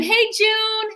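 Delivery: high-pitched character greeting; Kathy impression. Guest greets June as the housewife character, high put-on voice. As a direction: call out in a put-on chirpy housewife voice. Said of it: "just like Kathy would have been".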